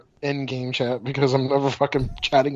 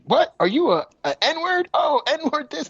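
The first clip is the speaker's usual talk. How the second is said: imitating kids; 10 semitones higher, slower than usual. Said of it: "they're just like".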